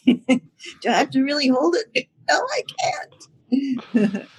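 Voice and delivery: high, breathless laughing plea; laughing panic. Retold or imitated. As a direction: laugh through a panicked protest. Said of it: "then you're thinking".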